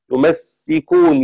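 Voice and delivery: يمسكون said slowly with an open mim and a doubled sin. Shown as a demonstration. Teacher showing the doubled-sin reading, slow and careful. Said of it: "بتشديد السين ويلزمه فتح الميم".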